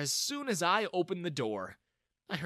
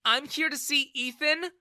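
higher, louder cold retort; a daughter's angry answer to her father; angry reply; 12 semitones higher, 6 dB louder than usual. Acted. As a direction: snap back at him. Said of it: "she replied angrily".

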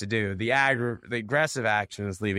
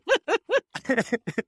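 high, sudden laugh breaking out mid-story; burst of laughter; 3 dB louder than usual. Real, not acted. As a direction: laugh out at your own retold insult. Laughing at own swearing story.

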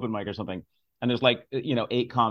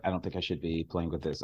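put-on lower, softer earnest kid voice; a hint of a serious little boy, hushed; imitating his son; 9 semitones lower, 9 dB quieter than usual. Six-year-old son worried about toy age rules, hushed earnest voice.

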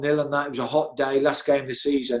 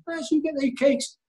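mimicked boss voice; 12 semitones higher than usual. Boss teasing Baz about Friday cakes, high mock voice. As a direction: pipe it up high, teasing the kitman. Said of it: "the same thing".

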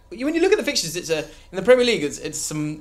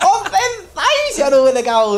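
high, laughing, cocky striker; Lucas Moura impression; 9 semitones higher, slower than usual. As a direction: giggle through the boasts. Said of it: "It's me. Lucas Moura".

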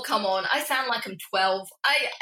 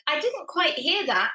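higher assistant reply; retold Siri reply; 7 semitones higher than usual. Guest imitating Siri from the pocket, lightly voiced assistant. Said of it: "she's like".

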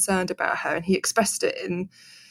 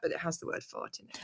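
whispering; 14 dB quieter than usual. Real, not acted. Naughty word for her boys.

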